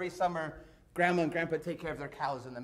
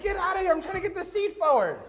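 very high, raised voice; retold frantic shout; 12 semitones higher, 5 dB louder, faster than usual. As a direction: imitate shooing a dog in a panic. Frantically pushing the dog away.

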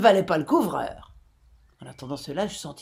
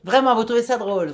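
growled retort; 6 semitones higher, 7 dB louder than usual. A narrator's reading. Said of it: "grogna le capitaine".

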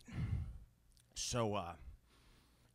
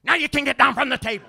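much higher, louder and fast; exasperated outburst; 13 semitones higher, 14 dB louder, faster than usual. Acted. Dad finally letting kid off the table, exasperated burst. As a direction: burst out in mock permission.

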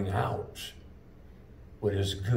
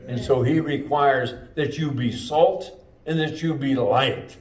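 louder, rising sermon climax; closing sermon build, loud and higher; full-force preaching; 3 semitones higher, 5 dB louder than usual. Acted. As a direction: call the church to action.

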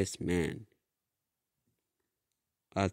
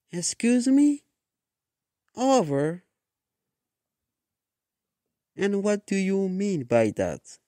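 high-pitched, louder, affronted questioning; indignant disbelief; 12 semitones higher, 5 dB louder than usual. A narrator's reading. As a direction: act out shocked offence, pitched way up. Reacting to being dumped in story.

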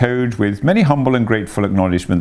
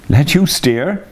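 laughing; 8 semitones higher, 4 dB louder, slower than usual. A narrator's reading.

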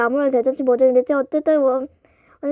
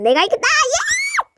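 victory shout; 11 semitones higher, slower than usual. Acted.

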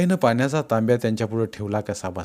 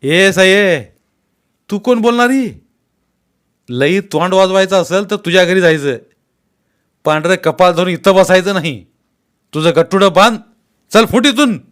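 much higher and louder raging scolding; furious yelling; 7 semitones higher, 8 dB louder than usual. Acted. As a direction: scream her out of the house. Uncle drives Saibai out, raging.

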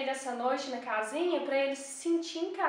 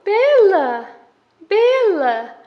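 calling the dog; 5 semitones higher, 15 dB louder, much slower than usual. Real, not acted. Chamando a cachorra.

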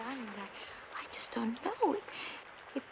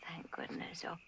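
relieved whisper; 9 semitones lower, 10 dB quieter than usual. Acted. Relieved, kitten found.

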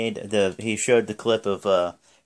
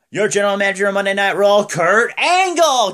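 Vince; 9 semitones higher, 6 dB louder than usual. Retold or imitated. Boss revealing Kurt Angle, hammed-up announcer roar. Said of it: "And Vince is like".

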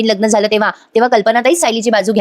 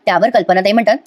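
ordinary delivery

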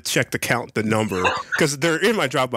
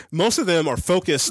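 ordinary delivery

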